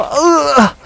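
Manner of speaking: sudden high yelp of alarm; startled yell. Real, not acted. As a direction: cry out as you get hit. Dying in a game fight.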